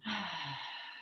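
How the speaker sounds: long, soft falling exhale; releasing sigh. Shown as a demonstration. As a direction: sigh it out softly to let everything go.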